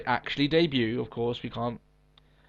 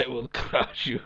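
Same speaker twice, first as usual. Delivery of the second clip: put-on Russian accent; 5 dB quieter, slower than usual. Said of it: "Ivan Drago from Rocky".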